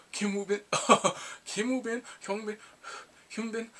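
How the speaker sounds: high questioning disbelief; amused disbelief. Retold or imitated. Viewer reacting to familiar actor names, high amused disbelief.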